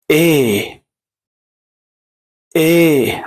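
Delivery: long, held "إيه", like a drawn-out "ey"; second E sound. Shown as a demonstration. Teacher models the second pronunciation, long drawn-out "إيه". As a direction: stretch the vowel of "إيه" and let it fall. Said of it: "النطق التاني".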